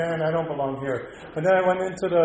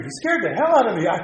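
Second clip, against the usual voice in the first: usual speaking voice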